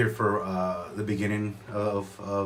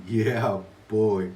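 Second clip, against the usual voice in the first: usual speaking voice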